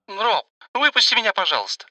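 put-on high, begging young girl's voice; pleading girl. A narrator's reading. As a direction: plead like a scared teenager. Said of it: "взмолилась она".

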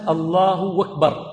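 "أكبر" said as "وَكبر", straight after "الله"; slow "الله أكبر" run together as "Allahu wakbar"; waw replacing hamza. Shown as a demonstration. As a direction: drop the hamza of "أكبر" and glide in with a w. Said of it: "الهمزة ويأتي بالواو".

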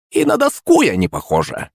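high huffy crone voice; offended. Acted. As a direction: take offence like a vain hag. Witch offended at comparison.

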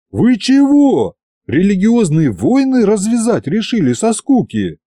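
high, startled yelp of protest; horrified outcry. A narrator's reading. Servant horrified by the tsar's question, high alarmed outcry. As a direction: cry out in horror, pitch jumping up. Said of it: "не обратил он внимания на мои вопли".

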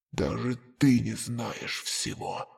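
low, slow voice echoing in the mind; a dying voice echoing inside his head; distant flashback echo. Acted. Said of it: "эхом прозвучало в голове". (далёкое эхо в голове)

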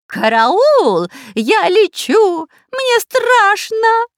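high, slow panicked wail; frightened wailing. A narrator's reading. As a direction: wail in terror, dragging the words. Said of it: "надрывался шпион Дырка".

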